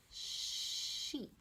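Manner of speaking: "sheep" whispered, long sh onset. Shown as a demonstration. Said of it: "the last one".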